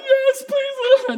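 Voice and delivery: high, slow, hammed-up sweet mom voice; mock eager mom. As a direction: squeak it out like an overjoyed mother. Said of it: "You would like".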